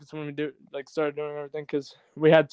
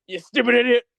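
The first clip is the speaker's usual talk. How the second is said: higher-pitched catchphrase jab; Chris Jericho; 7 semitones higher, 4 dB louder than usual. Acted. Quoting a wrestler to tease co-host over bad math, mock scolding. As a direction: throw out a wrestler's mock insult. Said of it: "as Chris Jericho would say".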